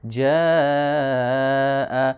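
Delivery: جا stretched long on the vowel; elongated madd reading. Shown as a demonstration. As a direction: hold the aa of جا. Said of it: "اس کو ہم نے پانچ second لمبا کرنا ہے۔".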